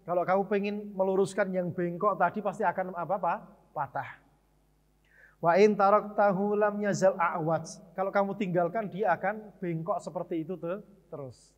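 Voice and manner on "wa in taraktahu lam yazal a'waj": slow, measured Arabic recitation, louder than his talk; Arabic hadith recitation. Shown as a demonstration. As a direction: recite the hadith solemnly and deliberately.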